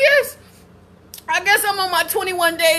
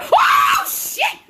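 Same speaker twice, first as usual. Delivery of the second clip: high, sudden shriek of alarm; startled scream; 13 semitones higher than usual. Real, not acted. Woman surprised by a loose bird, startled scream. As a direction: shriek as something startles you.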